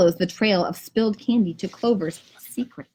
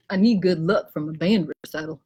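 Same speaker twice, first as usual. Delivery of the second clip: Gus's voice; 7 semitones lower than usual. Acted. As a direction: lower voice for Gus. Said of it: "Gus says".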